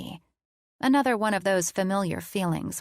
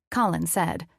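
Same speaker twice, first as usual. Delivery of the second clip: ordinary delivery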